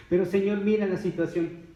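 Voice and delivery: high-pitched acted complaint; protesting plea. Acts believer protesting to Jesus. (queja suplicante actuada)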